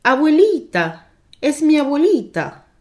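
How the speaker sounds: high, loud, delighted call-out; joyful cry. A narrator's reading. Anne spotting her lost grandmother in the park, joyful cry. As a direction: call out in relieved joy, voice lifted. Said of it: "oyen gritar detrás suyo".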